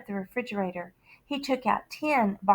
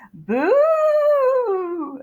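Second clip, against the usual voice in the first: spooky boo; 14 semitones higher, 7 dB louder than usual. Acted. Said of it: "lifted up the pillowcase and he said".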